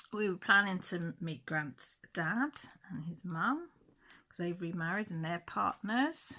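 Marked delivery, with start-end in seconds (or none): none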